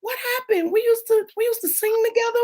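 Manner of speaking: high, pleading retold questions; hurt plea. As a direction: plead with a friend who turned cold. Retelling hurt plea to old friend.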